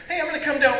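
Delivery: chirpy high call-out; high called-out announcement. Acted. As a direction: sing it out to the crowd. Calling out to audience.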